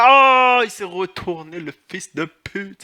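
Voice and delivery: sudden high loud yelp of fright; startled cry. Real, not acted. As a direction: jump out of your skin with a cry. Surpris par un ennemi. (cri de surprise soudain, aigu et fort)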